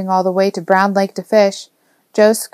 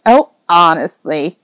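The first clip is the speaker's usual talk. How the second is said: slow, exasperated huff; huffy old woman; 5 dB louder, slower than usual. A narrator's reading. Old neighbour scolds two loiterers, huffy. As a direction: huff it out as a fussy elderly neighbour. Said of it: "Missus Newman huffed".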